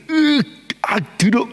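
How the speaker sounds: high strained groan, then a grumbled 들어; a hint of irritated disgust; mock annoyed groan. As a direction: play the healed man grumbling at being spat on. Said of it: "이렇게 하는 게 아니죠".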